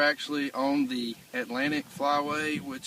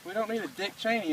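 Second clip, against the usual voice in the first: scolding; 7 semitones higher than usual. Real, not acted. Scolding buddy over gun safety.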